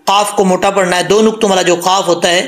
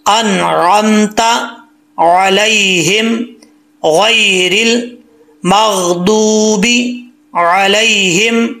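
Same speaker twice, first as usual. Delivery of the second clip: slow, drawn-out melodic chant; tajweed chanting; Quranic recitation; much slower than usual. Shown as a demonstration. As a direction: recite each phrase slowly and melodically. Teacher recites Surah Fatiha.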